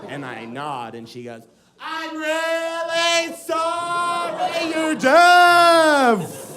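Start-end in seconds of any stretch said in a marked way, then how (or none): 1.8-6.6 high, very slow, drawn-out shout; yelled apology. Retold or imitated. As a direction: bellow each word slowly for a deaf listener, imitating the girl. Yelled apology to supposedly deaf man.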